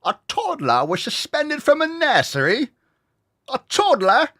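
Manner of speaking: put-on British accent, aghast. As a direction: squeal it in a jokey British accent. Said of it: "making fun of the accent".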